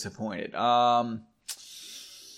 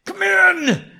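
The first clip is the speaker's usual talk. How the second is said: very high, loud annoyed yell; mad shout; 9 dB louder than usual. Acted. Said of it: "Or is he mad?".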